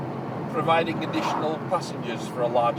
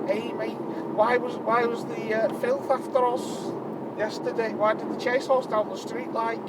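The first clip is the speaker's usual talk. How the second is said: higher, mock Scouse chav voice; Scouse accent; 8 semitones higher than usual. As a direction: lightly imitate a stoned Scouse lad. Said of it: "I've gone, "Scouse".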